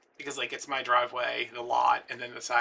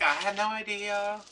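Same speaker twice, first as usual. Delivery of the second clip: high, slow, drawn-out reply; calling back; 8 semitones higher, 4 dB louder, slower than usual. Retold or imitated. Answering neighbours about her daddy, high drawn-out call. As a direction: draw it out high, mock-polite.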